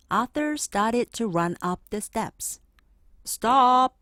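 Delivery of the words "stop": sharp loud "Stop!"; teacher shouting stop at a running boy. A narrator's reading. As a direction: bark it to halt the class. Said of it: "shouted Mister Redburn".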